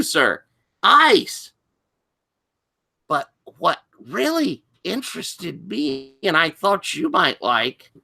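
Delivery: high, insistent student, slowing at the end; teenaged boy voice. Acted. Student telling the doctor a salacious tale, teen voice. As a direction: insist like a teen, drawing out the last words. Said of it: "teenaged boy voice".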